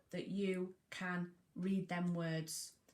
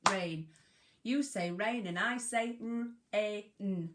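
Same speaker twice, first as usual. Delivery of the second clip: ordinary delivery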